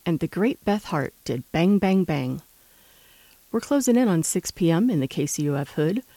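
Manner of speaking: ordinary talk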